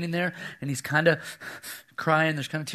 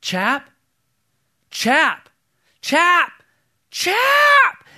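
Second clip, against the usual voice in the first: calls building from loud to a near-scream, much higher each time; little boy lost in a cave calling louder and louder; rising panicked calls; 13 semitones higher, 9 dB louder, much slower than usual. Retold or imitated. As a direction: imitate a scared five-year-old calling his brother. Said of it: "his voice is like this".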